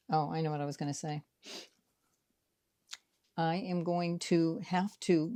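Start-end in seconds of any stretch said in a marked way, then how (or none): none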